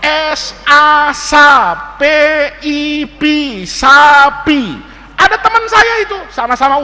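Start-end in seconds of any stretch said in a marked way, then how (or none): 0.0-4.8 slow spelling aloud, syllable by syllable; teacher sounding out. Acted. Teacher reads spelling to first graders, slow and deliberate. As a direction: sound it out slowly for a class. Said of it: "dibacain tuh sama guru".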